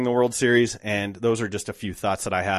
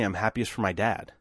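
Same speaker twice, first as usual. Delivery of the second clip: ordinary talk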